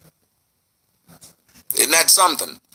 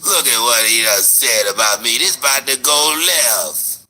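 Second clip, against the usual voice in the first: mocking whiny voice; 5 semitones lower, slower than usual. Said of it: "tell my girl".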